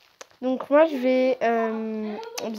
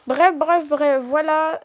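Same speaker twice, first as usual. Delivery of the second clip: upbeat wrap-up; 5 semitones higher, 6 dB louder, slower than usual. Real, not acted. Wrapping up, louder.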